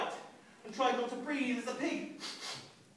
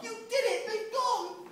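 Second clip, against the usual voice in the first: high, bright relieved exclamation; joyful; 10 semitones higher, 4 dB louder than usual. Acted. Boy thanks Auntie Em, overjoyed. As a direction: celebrate with relief. Ralph rejoices.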